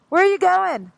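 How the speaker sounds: loud, high call to a rider ahead. Real, not acted. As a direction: shout it across the traffic. Calling out to a friend riding ahead.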